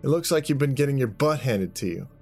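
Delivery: high-pitched mocking jibe; taunting. Jack taunting the man mid-fight, mocking.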